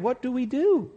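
high-pitched helpless question; flustered host. Acted. Host wondering how to thank God, flustered.

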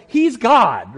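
loud, drawn-out "he's God"; overwhelmed emphasis. Acted. Flustered host reminding the others who's coming, overwhelmed emphasis.

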